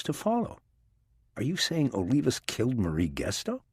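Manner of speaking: lightly voiced skeptical woman; a hint of a doubtful agent; female character voice. A narrator's reading. Rachel's voice in the narration.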